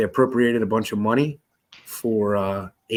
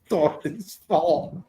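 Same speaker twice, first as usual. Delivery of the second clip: put-on slow, higher mumbling old-man line; 6 semitones higher, slower than usual. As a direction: drawl it like a tired president.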